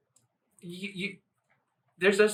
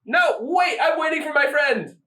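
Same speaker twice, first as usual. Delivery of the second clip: whiny protest; 9 semitones higher, 3 dB louder, slower than usual. Retold or imitated.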